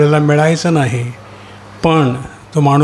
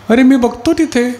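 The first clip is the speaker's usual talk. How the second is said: ordinary talk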